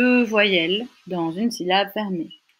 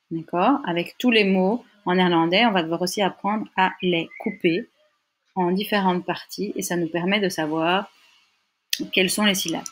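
usual speaking voice

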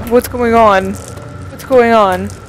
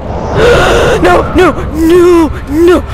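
whimpering, desperate no's; sobbing in panic as it closes in; panicked crying; 5 semitones higher, slower than usual. Real, not acted. Monster attacks, crying out.

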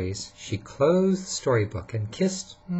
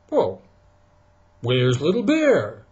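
Papa Bear puzzled; 3 semitones higher, 6 dB louder than usual. Acted. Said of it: "said Papa Bear".

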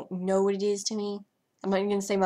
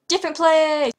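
much higher, louder shout; excited shout; 8 semitones higher, 11 dB louder than usual. Real, not acted. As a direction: announce it loud. Calling out decision to move.

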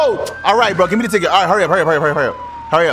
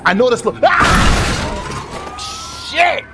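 startled yell at the crash; sudden shocked shout; 6 semitones higher, much slower than usual. Real, not acted. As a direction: yell out as the car wrecks. Crashing car in game.

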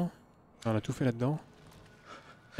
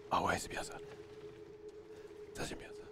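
whispered to himself; 13 dB quieter than usual. Real, not acted. Muttering to himself.

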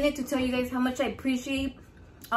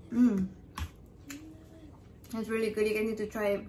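talking through a big bite; chewing while talking; mouth full; 2 semitones lower, 6 dB quieter than usual. Real, not acted. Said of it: "I need to take smaller bites".

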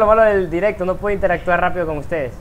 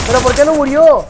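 high, loud frustrated shout; raging gamer; 7 semitones higher, 7 dB louder than usual. Real, not acted. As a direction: yell at the game in disbelief. Enemy survives, streamer shouts.

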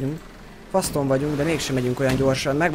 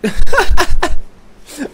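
hangos nevetés; 14 semitones higher, 11 dB louder than usual. Real, not acted. Teammate falls off ship.

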